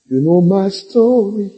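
milk the opening of a testimony; drawn-out testimony. Retold or imitated. Peter opening his testimony to the brethren, slow and drawn out.

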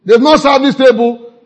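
loud high mock call to a waiter; mock rude guest shouting at servers. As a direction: bark for the waiter, hammed up. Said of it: "I don't like to say".

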